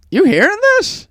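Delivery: high-pitched put-on astonishment; wildly overdone wonder at an ad; mock amazement. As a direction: act amazed to bait someone. Baiting dad over radio ad.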